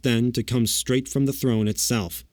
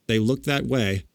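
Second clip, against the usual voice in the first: usual speaking voice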